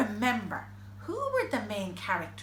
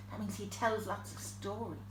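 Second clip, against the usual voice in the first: plain reading voice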